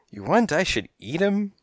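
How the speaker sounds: high cat goon voice; eager henchcat. A narrator's reading. Yellow cat offers boss his services, eager. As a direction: offer it high, rising into the question.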